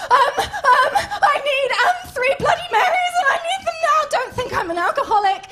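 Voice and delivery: very high, loud, whining wail; hammed-up tearful shrieking; frantic Menstruation character. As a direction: order drinks in mock hysterics. Acts frantic menstruation ordering drinks.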